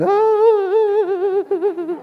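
high, sustained wailing sob; mock crying. Mimicking pastor and leaders in tears, exaggerated high crying. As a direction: imitate a wavering high-pitched wail. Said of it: "they all started crying".

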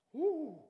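high, loud waking whoop; sudden whoop. Acted. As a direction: whoop as if jolted awake. Spirit waking up, acted out.

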